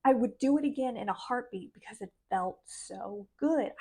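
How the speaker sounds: ordinary talk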